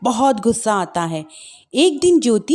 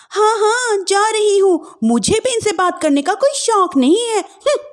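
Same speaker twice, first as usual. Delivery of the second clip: higher, huffy dismissive bride; sulky young wife; 8 semitones higher than usual. Acted. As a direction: storm off in a huff. Wife leaves sulking.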